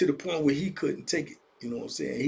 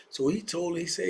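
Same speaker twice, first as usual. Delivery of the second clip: usual speaking voice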